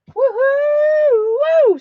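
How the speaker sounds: very high, loud whoop; excited woohoo. Real, not acted. Host celebrating a buyer's purchase, loud high whoop. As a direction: whoop like your team just scored.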